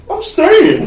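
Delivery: mock goody-goody friend. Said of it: "He's like this".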